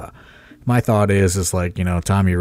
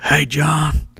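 put-on raised, rambling old wrestler voice on the phone; Tommy Rich impression; 8 semitones higher, slower than usual. Imitating Tommy Rich calling the promoter, high and rambling. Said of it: "my Tommy Rich impression was pretty good".

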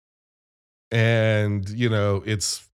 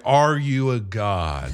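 slow, lower, portentous; ominous god-like question; 6 semitones lower, slower than usual. Retold or imitated. Gozer questioning Ray, slow and ominous. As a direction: draw the question out with mock gravity.

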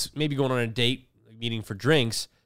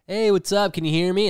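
higher-pitched acted video-call hello; calling out greeting; 6 semitones higher, 4 dB louder than usual. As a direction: check the connection in a raised voice. Acted awkward Zoom call greeting.

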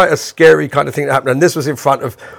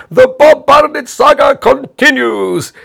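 put-on high, loud, slow showman announcement in an Italian accent; TV host announcing; 11 semitones higher, 5 dB louder, slower than usual. Host teasing Bob on air, loud showman announcement. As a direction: proclaim it like a soap-opera trailer. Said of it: "he went".

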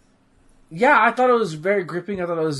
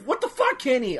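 much higher, indignant yelp at Kenny; mock outrage; 7 semitones higher than usual. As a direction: replay your outrage at the game character. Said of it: "I was legit mad on stream".

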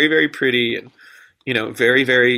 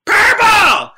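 high, loud mock heckler scream; sudden shrill-sounding shout from the crowd; 9 dB louder than usual. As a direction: yell it out like a rowdy heckler. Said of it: "screams out".

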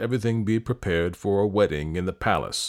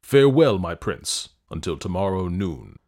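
booming warrior king; 5 dB louder than usual. Acted. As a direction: shut him down with royal force. King angrily silences the prince.

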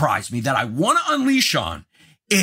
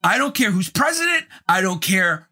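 1 indignant rant; 5 semitones higher than usual. Real, not acted. Ranting at the booing crowd.

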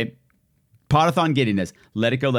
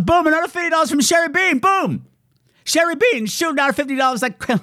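high excited boom announcement; high excited hype-man boom; 13 semitones higher than usual. Real, not acted. Hyped donation shout.

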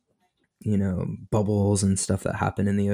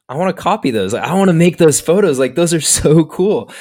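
higher, louder, gushing enthusiasm; excited fan; 8 semitones higher, 7 dB louder than usual. Retold or imitated. Photographer recalling first seeing Ben's photos, excited gushing. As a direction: gush over them, laughing near the end. Retells being thrilled by photos.

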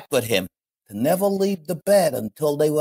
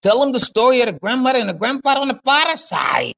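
calling out; 7 semitones higher than usual. Acted. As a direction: call across the room. Calling out to Mikey.

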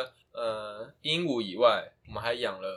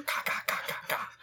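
softer, voiceless cawing; rasped little caws; bird squawking; 7 dB quieter than usual. Retold or imitated. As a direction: mimic the rough-voiced bird. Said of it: "那两个声音在混杂".

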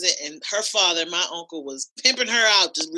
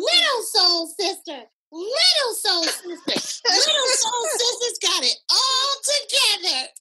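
put-on much higher, slow sing-song child recital; high, slow, proud little girl's poem; toddler reciting; 13 semitones higher, slower than usual. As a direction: perform it like a three-year-old's big finish. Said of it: "And at the end it said".

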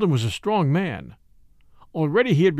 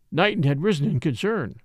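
plain reading voice

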